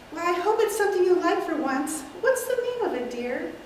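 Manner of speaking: a sweet aunt teasing her nephew; elderly aunt. Acted. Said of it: "take several characters".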